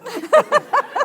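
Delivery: high, loud laugh of joy; joyful laugh. Retold or imitated. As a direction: burst out laughing.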